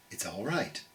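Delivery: calm yourself down gently under your breath; soft self-reassurance. Acted. Tells herself softly in story.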